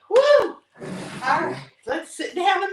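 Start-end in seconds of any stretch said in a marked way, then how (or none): 0.1-0.6 loud, high woo; woo cheer. Real, not acted. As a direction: cheer yourself on after the set. Cheering end of set.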